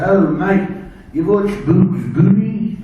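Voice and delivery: loud, jolly Australian officer; Australian accent. Retold or imitated. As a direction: boom it out like an Aussie major. Australian major asks speaker.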